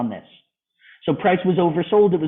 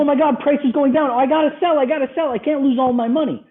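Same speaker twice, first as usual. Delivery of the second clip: high, frantic panicky small investor; mock panic; 9 semitones higher, faster than usual. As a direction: squeal it like a scared little investor losing everything. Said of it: "we are freaking out and being like".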